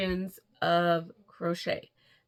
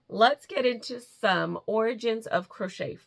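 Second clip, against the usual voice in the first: normal talking voice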